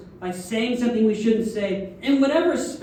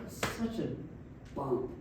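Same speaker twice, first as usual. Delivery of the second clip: much softer, lower, sheepish; ashamed murmur; 7 semitones lower, 13 dB quieter, slower than usual. Retold or imitated. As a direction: sink into shame. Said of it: "immediately feel like".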